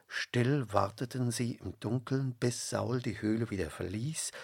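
ordinary delivery, nothing marked

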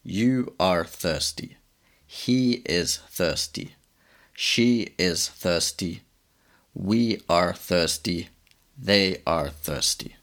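You are thirsty, He is thirsty said slowly and clearly; slow teaching drill. Shown as a demonstration. Teacher drilling thirsty with each pronoun, slow and careful. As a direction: spell it out slowly for the class. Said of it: "En inglés decimos".

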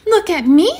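high-pitched little kitty showing off; proud, high kitten voice; eager kitty. Acted. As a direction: call out for attention in a high voice. Kitty shows off once more.

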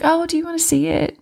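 a sweet, high-pitched stranger offering the puppy; imitating the sweet dog lady. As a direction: put on the lady's sugary voice to tempt them. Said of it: "And she's like".

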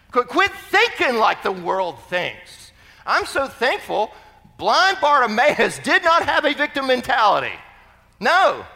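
loud, emphatic exhortation rising in pitch; full-force preaching. Acted. Preacher urging people to quit victim thinking, forcefully. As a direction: drive it home to the congregation.

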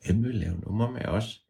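plain soft voice; quiet. Shown as a demonstration. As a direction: ask it softly to yourself.

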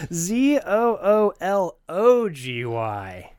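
slow letter-by-letter spelling. Shown as a demonstration. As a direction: spell each letter out slowly. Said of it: "The word is zoology".

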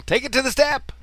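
high, punchy call to the dog; yelled dog command. Retold or imitated. Boy on paper route commanding his dog, yelling. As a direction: call out an order across the yard. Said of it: "I'd point and yell".

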